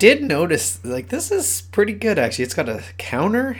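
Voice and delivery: high, delighted about the counter; gleeful discovery; excited. Real, not acted.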